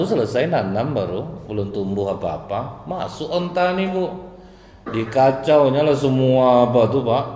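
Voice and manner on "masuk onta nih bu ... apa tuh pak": excited telling. A narrator's reading. Vivid telling of the ravaged garden.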